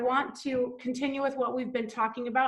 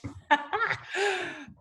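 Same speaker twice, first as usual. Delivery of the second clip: real laugh; 6 semitones higher than usual. Real, not acted. Laughs at her own story.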